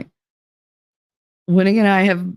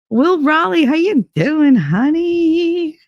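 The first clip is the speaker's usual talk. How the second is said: sing-song greeting; 10 semitones higher, slower than usual. Real, not acted. Greeting a viewer in chat.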